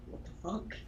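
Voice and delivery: breathy whispered what the fuck; whispered confusion. Retold or imitated. Gamer reacting to a baffling quest name, whispered. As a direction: mutter it under your breath, puzzled.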